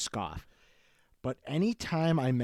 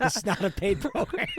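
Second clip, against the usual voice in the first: laughing; 10 semitones higher, 3 dB louder than usual. Real, not acted. Laughing through joke.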